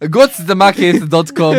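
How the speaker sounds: put-on high, loud African accent through laughter; giggly African-accented ad slogan; mock African accent. Parodying meerkat advert, laughing African accent. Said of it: "like an African person".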